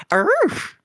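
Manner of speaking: very high yappy bark; high little arf; puppy arf. Pretend. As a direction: yip like a tiny puppy. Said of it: "I'd probably be like".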